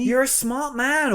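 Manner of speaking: slow high sarcastic praise; drawn-out mocking compliment. Sarcastic jab at Cortex.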